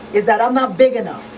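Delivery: ordinary talk, nothing marked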